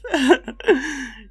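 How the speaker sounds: loud crone cackle; witch cackle. Acted. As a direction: cackle till tears come. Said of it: "wiped a tear from her eye".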